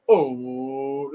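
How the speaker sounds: a lightly voiced fan's frustrated groan; mock groan of the crowd; imitating groaning fans. Crowd groaning at the keeper, mildly imitated. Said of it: "The fans are already on you going".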